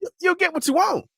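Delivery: very high, singsong assurance; coaxing promise. Acted. Telling casting to just open their ears, high coaxing. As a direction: pitch it way up, quick and coaxing.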